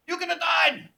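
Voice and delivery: high, loud alarmed reproach; alarmed scolding. Retold or imitated. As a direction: drive home the danger. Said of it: "she went up one side of me, down the other".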